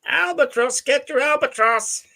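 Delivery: very high-pitched hawker calling out wares; street vendor's cry. Pretend. Friend riffing on cooked seagulls, mock vendor's call. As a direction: sing out a drawn-out seller's cry, high.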